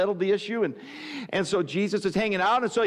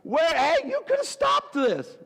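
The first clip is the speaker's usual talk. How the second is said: high, slow, pleading rebuke; Martha's hurt, high-pitched accusation; Martha reproaching Jesus; 11 semitones higher, slower than usual. Acted. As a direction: reach up high to blame him for not coming. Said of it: "She hears word that he's coming".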